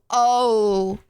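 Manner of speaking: ordinary talk, nothing marked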